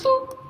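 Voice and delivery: high, loud little boop; squeaky mimicked nose-boop; boop sound effect. A narrator's reading. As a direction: pop out a playful high boop. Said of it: "complete with sound effect".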